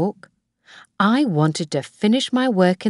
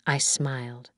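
ordinary delivery

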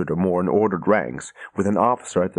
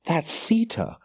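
shocked recognition; 10 semitones higher, slower than usual. A narrator's reading. Egwene recognizes her captor.

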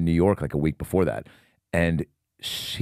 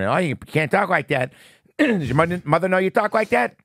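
high-pitched playful telling-off; mock scolding; 9 semitones higher than usual. Cop actor turns on a cheeky kid, mock scolding. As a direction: act scandalized, rising higher as you go.

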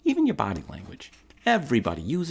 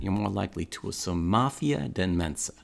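ordinary talk, nothing marked

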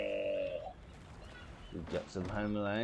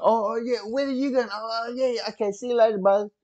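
much higher, breezy fake-friendly send-off; mock casual; 10 semitones higher, 6 dB louder, faster than usual. Mocking the hero who lets warriors swim away, breezy high send-off. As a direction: sarcastically wave them off as if it's nothing. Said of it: "Instead of going like".

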